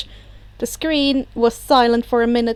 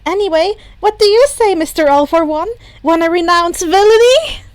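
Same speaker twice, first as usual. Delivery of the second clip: higher, louder, cheeky invitation; cheeky young hero; 6 semitones higher, 6 dB louder than usual. Acted. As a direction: pitch it playfully. Izuku high, loud, cheeky.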